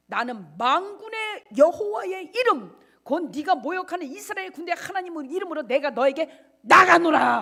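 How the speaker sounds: David declaring defiance to Goliath; defiant battle cry. Acted. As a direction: hurl the words at the giant.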